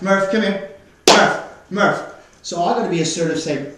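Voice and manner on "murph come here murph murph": louder call across the yard; firm call to bring the dog over. Real, not acted. Trainer calling Murph to come, loud firm call.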